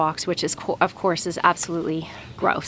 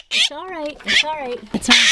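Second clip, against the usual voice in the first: high, tender reassurance; 13 semitones higher than usual. Real, not acted. Calming the fledgling.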